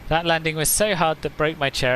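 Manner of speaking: ordinary talk, nothing marked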